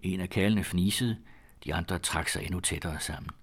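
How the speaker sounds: plain reading voice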